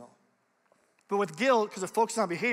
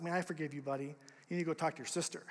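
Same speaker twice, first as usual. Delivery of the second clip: gentle forgiveness; 4 semitones lower, 8 dB quieter, faster than usual. Retold or imitated. Dad's gentle reply.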